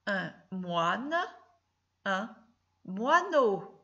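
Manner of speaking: each word read slowly and clearly, one by one; slow careful word reading. Shown as a demonstration. Teacher reading a word list to a pupil, slow and clear. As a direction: read the list aloud slowly so the child hears every sound. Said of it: "je vais les lire".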